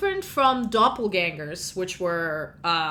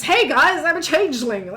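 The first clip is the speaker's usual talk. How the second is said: mock cheerful announcement; 7 semitones higher, 7 dB louder than usual.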